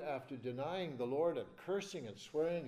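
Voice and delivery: ordinary talk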